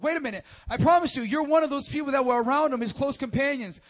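high, loud pointing-out; loud accusation. Acted. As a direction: call him out in front of everyone. People accuse Peter.

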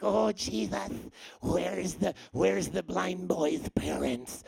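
slow sneering villain; sinister villain voice. Retold or imitated. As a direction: drag it out like a scheming villain. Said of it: "like a character out of Harry Potter".

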